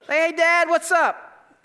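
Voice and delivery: put-on high, bright-eyed boy calling dad; a carefree kid greeting his father; cheery young son. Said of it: "how does Abraham talk to his son".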